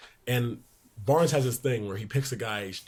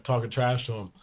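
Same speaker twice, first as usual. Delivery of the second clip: usual speaking voice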